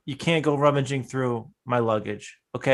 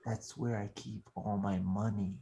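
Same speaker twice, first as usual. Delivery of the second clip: low, hushed secret; hushed confession; 8 semitones lower, 13 dB quieter than usual. Acted. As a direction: confide it almost whispering. Revealing secret money stash.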